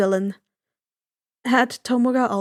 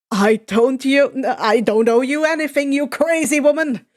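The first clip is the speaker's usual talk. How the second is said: angry retort; 5 dB louder than usual. Acted. Kacchan snaps back in argument.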